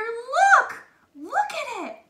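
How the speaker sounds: high, loud eager call; excited child. A narrator's reading. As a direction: make them look. Son points at dough.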